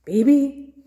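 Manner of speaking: taken-aback innkeeper; surprised question. A narrator's reading. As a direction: repeat it as a startled question.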